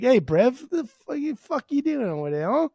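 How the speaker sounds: a hint of an aggressive Essex bloke; mock British lad. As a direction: play a cocky football lad. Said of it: "trying to be, like, a dick".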